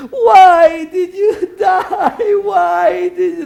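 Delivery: hammed-up graveside lament; mock wailing. Mourner wailing again.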